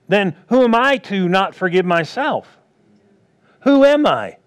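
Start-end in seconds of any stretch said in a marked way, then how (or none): 3.6-4.4 high, loud rhetorical cry; emphatic outcry. Real, not acted. Preacher to congregation, a loud rhetorical cry. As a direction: throw the question out to the congregation.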